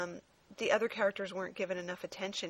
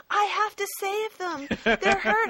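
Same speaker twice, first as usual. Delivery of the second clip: much higher, louder melodramatic plea; mock frantic hero; 12 semitones higher, 8 dB louder, slower than usual. Mocking a cartoon hero to co-host, high frantic melodrama. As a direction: ham up the lovesick hero's panic.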